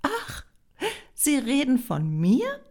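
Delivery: high gasped oh, then question; astonished realisation. A narrator's reading. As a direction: ask in amused amazement. Greta realises she is accused.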